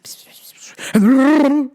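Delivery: imitated whispering. Mocking actors whispering on the show, hissed pss sounds. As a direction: mimic mumbled whispers. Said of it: "Everybody's like".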